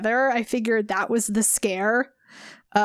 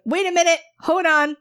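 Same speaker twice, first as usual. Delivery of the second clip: excited interruption; 6 semitones higher, 5 dB louder than usual. Retold or imitated. Retold interrupting the reading.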